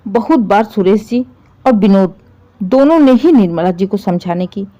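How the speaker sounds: ordinary delivery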